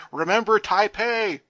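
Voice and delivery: high, loud mock shout; hammed-up yelling. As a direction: yell it like a manager at ringside. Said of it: "You hear James Vandenberg yelling".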